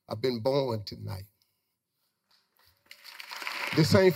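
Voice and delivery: low, choked retelling of tears in the car; retold crying. To Gladys on the drive home, low tearful voice. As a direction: let the voice sink low, half-crying with joy. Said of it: "I'm crying the entire time".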